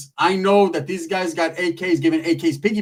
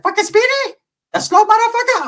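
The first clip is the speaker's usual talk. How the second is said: put-on high, loud scornful accent; Shorty mocking; 4 dB louder than usual. Shorty scoffing at Speedy, high and loud. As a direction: sneer at Speedy. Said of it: "He's like".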